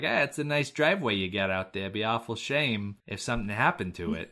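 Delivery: mock Jersey shakedown voice; New Jersey tough-guy accent, put on for a bit. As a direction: play a New Jersey heavy making a veiled threat. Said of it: "giving this Australian character a Jersey accent".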